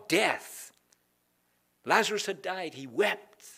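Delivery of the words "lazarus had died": ordinary talk, nothing marked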